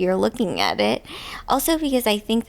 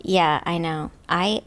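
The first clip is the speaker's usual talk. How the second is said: ordinary talk, nothing marked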